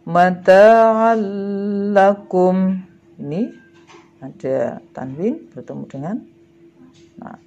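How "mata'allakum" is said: Mata'allakum loud and drawn out, long doubled l; merged reading. Shown as a demonstration. Teacher gives pupils another idgham example, merged recitation. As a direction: recite Mata'allakum slowly, holding the l with no hum. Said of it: "cara membacanya adalah lebur tanpa dengung".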